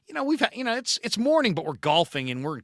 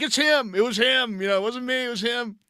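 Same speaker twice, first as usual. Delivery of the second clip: higher, rushed, pointing the finger; panicky blame; 9 semitones higher than usual. Retold or imitated. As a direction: nervously throw your buddy under the bus. Blaming buddy in front of angry pros.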